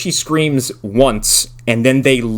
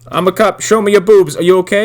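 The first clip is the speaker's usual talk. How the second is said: higher mock cop voice; 6 semitones higher, 3 dB louder than usual. Friends riffing on the cop, mock cop voice. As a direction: play the dumb cop, a bit louder. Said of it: "continuing cop impression".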